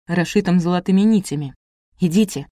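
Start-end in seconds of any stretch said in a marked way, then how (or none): none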